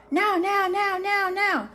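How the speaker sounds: lightly voiced excited dog; eager dog. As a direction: chant it insistently like an impatient dog.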